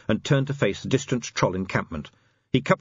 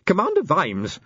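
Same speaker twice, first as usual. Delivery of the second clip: high-pitched, snappish inspector; snapped; 9 semitones higher than usual. A narrator's reading. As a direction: snap the commander's name and rank. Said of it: "snapped the inspector".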